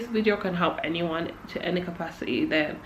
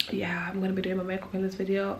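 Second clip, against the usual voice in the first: soft whisper; 9 dB quieter, faster than usual. Real, not acted. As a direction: drop to a whisper. Repeats line whispering.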